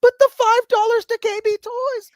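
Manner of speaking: high, loud mock child wail; kid dragged away. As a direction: imitate a kid protesting while hauled off. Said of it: "pulled away by".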